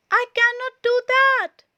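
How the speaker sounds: high and loud, upset; loud high protest; loud refusal. A narrator's reading. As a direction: protest at full voice. Todd refusing in alarm.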